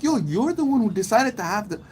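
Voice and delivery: outraged high-pitched rant; heated retort. Retold or imitated. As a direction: talk her down, pitch way up. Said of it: "I'm like".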